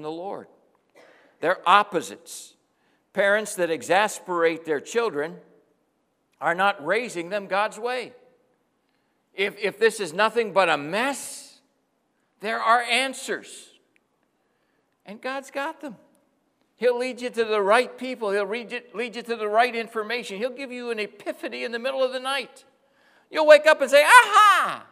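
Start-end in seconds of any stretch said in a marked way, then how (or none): 1.4-24.0 rising, louder preacher's fervor; preaching. Acted. Sermon builds to full force. 24.0-24.9 loud, high burst of discovery; sudden realization. Retold or imitated. You'll wake up and say.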